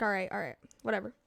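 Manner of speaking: low, very quiet brush-off; indifferent shrug-off. Retold or imitated. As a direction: drop your voice and dismiss it, retold. Retells his indifferent response.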